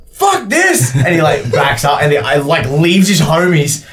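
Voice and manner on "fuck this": high panicked shout; freaking out. Acted. Game master as soldier abandoning his men, panicked shout. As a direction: cry out high, acting a soldier about to bolt. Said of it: "starts freaking out".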